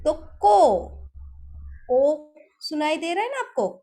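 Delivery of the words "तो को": को said loud and clear, the o vowel held long; clear "o" sound demo. Shown as a demonstration. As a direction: sound out को with a full round o. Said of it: "क को मैंने ओ की मात्रा दी".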